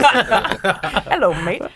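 playful British greeting through laughter; mock British accent. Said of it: "British accents".

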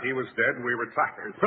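usual speaking voice

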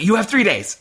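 high, emphatic mock threat; acted ultimatum. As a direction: deliver a melodramatic deadline. Villain gives acted ultimatum.

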